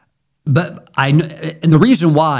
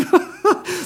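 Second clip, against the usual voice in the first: a quick airy giggle, pitched way up; high breathy laugh; 4 dB quieter than usual. Real, not acted. Preacher to congregation about the fast, high breathy laugh. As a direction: let a high unvoiced laugh slip out. Delighted at hidden hardship.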